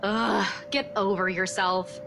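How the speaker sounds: low, slow groan of disdain; exasperated. Acted. Woman to boastful colleague, exasperated. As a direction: drag it out, pitch dropped, fed up.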